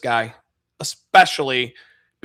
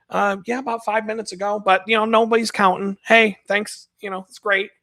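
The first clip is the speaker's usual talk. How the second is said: polite sarcasm; 7 semitones higher than usual. Retold or imitated. Adam replying to grandmother.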